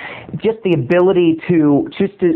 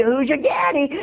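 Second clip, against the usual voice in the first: bully's taunt; 7 semitones higher than usual. Retold or imitated. Bully's catchphrase, retold.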